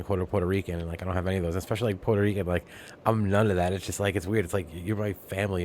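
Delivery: usual speaking voice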